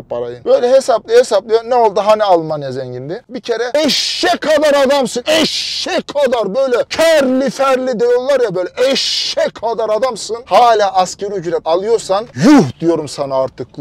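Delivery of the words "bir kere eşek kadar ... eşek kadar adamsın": exasperated scolding. Real, not acted. Vlogger berating a grown man on minimum wage, exasperated. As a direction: stretch the insult out in exasperation.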